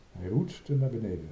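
ordinary delivery

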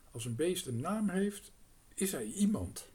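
the crow lecturing kindly. A narrator's reading. Crow explains his idea.

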